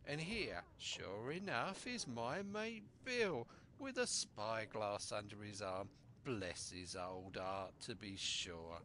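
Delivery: purring pirate with a rising grin; sly pirate. Acted. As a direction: coax the boy like a crafty buccaneer.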